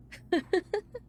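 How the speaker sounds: high, quick giggle; laugh. Acted. Teasing the librarian about the Omegaverse, high laugh. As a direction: break into a short, high laugh.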